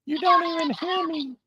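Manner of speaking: high squeaky helium voice; voice changer. Pretend. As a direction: talk like you sucked helium. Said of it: "You got a voice changer on there?".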